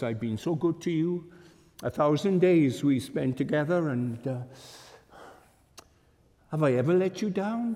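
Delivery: lightly voiced Jesus, wounded and pleading; a hint of a wronged friend asking why.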